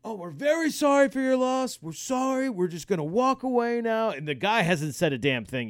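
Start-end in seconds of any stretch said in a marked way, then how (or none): 0.0-4.1 high-pitched, apologetic couple voice; mock conciliatory sing-out; placating bystander. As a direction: imitate someone nervously backing away with apologies.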